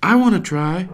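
put-on high, loud eager child voice; little girl yelling. Sage yelling to her family that she wants a turn, high and eager. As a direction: beg to go next, loud and high. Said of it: "Sage yells".

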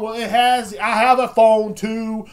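high, loud, drawn-out protest; indignant retort. Acted. Answering family's guilt trip, indignant shouted retort. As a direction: throw the blame back loudly.

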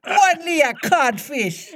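very high, slow taunt; high theatrical put-down, drawn out; mocking voice. A narrator's reading. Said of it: "replied the".

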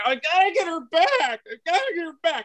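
high, fast, desperate pleading; hammed-up desperate teen, voice climbing high; frantic young self. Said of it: "I'm like".

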